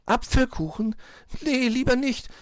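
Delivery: high wary chirp; tiny high bird voice; piping little bird. A narrator's reading. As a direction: squeak a nervous refusal. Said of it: "piepste Zwitschi".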